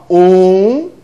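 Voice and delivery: loud, slow warning count; mother warning her son by counting. Retold or imitated. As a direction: count it out like a mother losing patience. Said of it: "ela dizia assim, ó".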